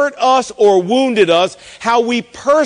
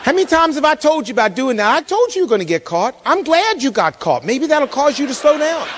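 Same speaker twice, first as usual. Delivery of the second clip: quick, lightly voiced scolding wife; half-hearted imitation of an exasperated wife; 5 semitones higher than usual. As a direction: rattle off a mock nagging told-you-so. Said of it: "my wife says to me".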